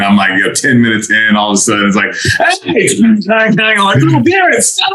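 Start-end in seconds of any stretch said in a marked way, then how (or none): 2.6-4.9 high-pitched imitation of noisy interrupters; mock calling out. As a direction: call out in a silly, much higher voice. Acts out loud interruption during call.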